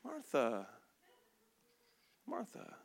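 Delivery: low, soft calming call. Retold or imitated. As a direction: settle her down gently. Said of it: "he kinda gets quiet".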